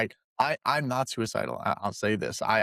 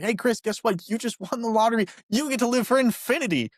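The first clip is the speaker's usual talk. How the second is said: high-pitched, put-on big-news delivery; mock excited announcement; 10 semitones higher than usual. Telling Chris he won eternal life, mock excitement. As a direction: announce a pretend prize, pitched way up. Acted announcement of a lottery win.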